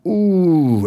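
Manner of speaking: ordinary talk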